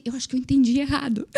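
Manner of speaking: high, quick, disbelieving quote; acted bewilderment. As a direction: act out thinking you misheard. Retells her bewildered reaction to offer.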